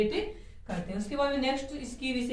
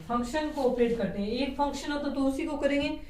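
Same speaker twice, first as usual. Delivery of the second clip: usual speaking voice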